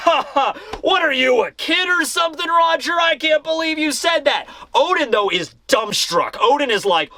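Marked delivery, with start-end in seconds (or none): 0.1-4.5 hammed-up mocking laugh; laughing ridicule. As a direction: howl at the idea, incredulous. Whitebeard laughs at Roger.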